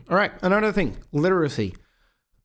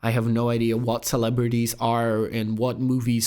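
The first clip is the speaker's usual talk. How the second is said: usual speaking voice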